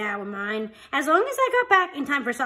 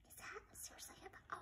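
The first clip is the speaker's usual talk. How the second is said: whisper; 31 dB quieter than usual. Real, not acted. Aside to a parent.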